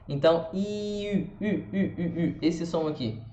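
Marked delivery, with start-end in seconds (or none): 0.5-2.4 long high I sliding into French U; I with U lips. Shown as a demonstration. Teacher repeating the demo, I becoming French U. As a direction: stretch I, then pucker into short repeated U. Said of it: "É um I com boca de U".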